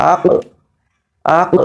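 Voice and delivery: আক with a bouncing stop on the final k; qalqala on আক. Shown as a demonstration. Teacher showing students the qalqala sound. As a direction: read আক, the k dropped with a small echo. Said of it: "একটা drop হবে".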